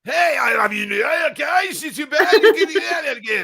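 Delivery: high, loud hammed-up New York accent with laughter; laughing over-the-top New York accent; mock New York accent. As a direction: shout it like a cartoon New Yorker. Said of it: "better than your Scottish and French".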